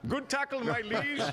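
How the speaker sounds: cheeky teenager mocking a royal from the sideline; mock royal courtier. Teen on the sideline teasing Prince William, hammed-up courtier voice. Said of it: "I was a young idiot teenager and I'm like".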